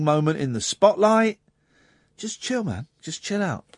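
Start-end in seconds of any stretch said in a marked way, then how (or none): 2.2-3.6 very soft, hushed reassurance; quietly placating; calming down. Real, not acted. Host tells caller to calm down.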